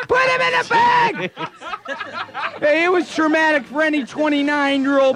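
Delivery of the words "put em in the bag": much higher, strained comic shout; mock outraged demand. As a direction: holler it in exaggerated fury. Repeats the outraged candy demand.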